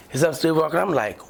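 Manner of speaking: ordinary talk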